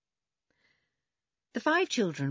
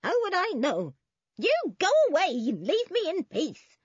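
put-on high, loud, cross old woman; agitated old woman; 13 semitones higher, 5 dB louder than usual. A narrator's reading. Old woman demanding to be left alone, high screech. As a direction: screech them out, pitched high.

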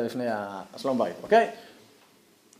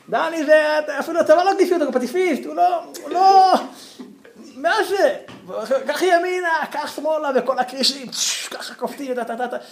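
excited onlookers; 12 semitones higher, 4 dB louder than usual. Retold or imitated. Onlookers shouting at the swimmer.

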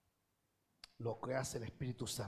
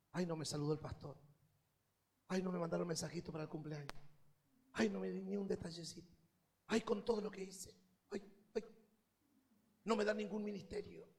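whiny churchgoer; 5 semitones higher, 3 dB quieter, faster than usual. Retold or imitated. Mocking a touchy churchgoer.

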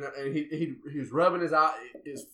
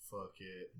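put-on quiet drunk mumble through laughter; laughing drunk mumble; 8 semitones lower, 20 dB quieter than usual. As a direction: mumble it while cracking up. Drunk friend quoted, laughing.

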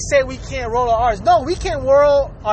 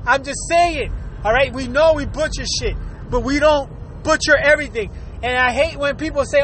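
ordinary talk, nothing marked

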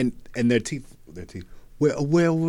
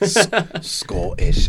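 mock Scottish; 8 semitones higher, 7 dB louder, much slower than usual. Mocking Scottish accent.

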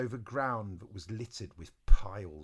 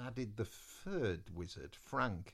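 usual speaking voice

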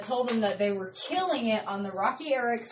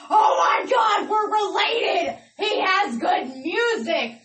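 loud, high, drawn-out gushing; excited reaction; 8 semitones higher, 7 dB louder, slower than usual. Retold or imitated. Radio host recalling her thrill at the song, excited shout. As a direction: shout it with slow, stretched-out excitement.

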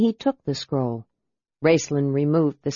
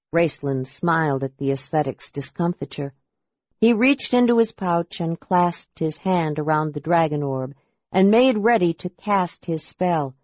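usual speaking voice

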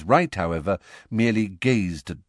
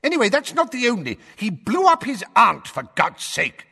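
higher, louder sputtering shout; 5 dB louder than usual. A narrator's reading. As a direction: burst out, losing patience. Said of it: "Fudge shouted".

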